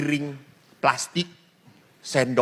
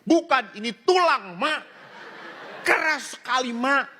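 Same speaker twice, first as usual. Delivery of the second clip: put-on child complaining; 9 semitones higher than usual.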